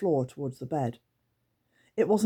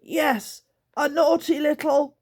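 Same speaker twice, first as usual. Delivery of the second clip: much higher, louder creature agreeing; squeaky creature chiming in; 11 semitones higher, 6 dB louder, slower than usual. Acted. Scratch agreeing, cut off.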